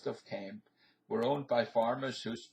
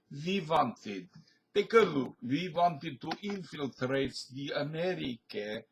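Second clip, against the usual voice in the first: put-on thick Russian accent, slowed and raised; hammed-up Soviet spy in a Russian accent; 3 semitones higher than usual. Jokester revealing he's a Soviet spy, in a thick Russian accent. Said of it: "switch back to my Russian accent".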